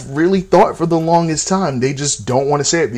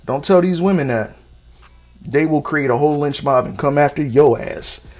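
ordinary talk

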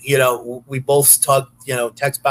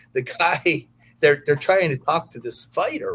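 ordinary delivery

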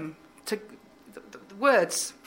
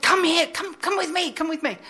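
eager call; 9 semitones higher, 5 dB louder than usual. Retold or imitated. Retold scientist calling her over.

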